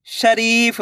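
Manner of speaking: "शरीफ" with the री drawn out; stretched long ई. Shown as a demonstration. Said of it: "री को खींच के पढ़ना है". ("शरीफ" में री को खींचकर)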